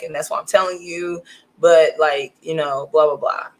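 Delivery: low, subdued phone confession, hesitant; confessing boyfriend. Retold or imitated. Boyfriend phones from the airport to confess he cheated, low and subdued. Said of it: "and was like".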